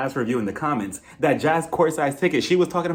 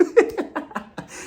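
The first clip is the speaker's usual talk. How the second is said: high airy laugh; breathy laugh; 13 semitones higher than usual. Real, not acted. Laughs at own joke.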